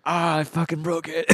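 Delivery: retold panic. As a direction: whine it out in mock alarm. Retells panic over injured arm.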